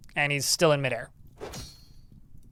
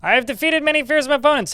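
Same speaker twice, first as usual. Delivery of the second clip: booming proud warrior boast, much higher and louder; boastful enemy; 11 semitones higher, 6 dB louder than usual. Acted. Player voicing an enemy bragging about defeated foes, grand boast. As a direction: puff up like a bragging champion.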